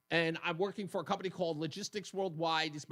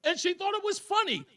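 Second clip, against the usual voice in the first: laughing; 12 semitones higher than usual. Real, not acted. Laughing through his defence.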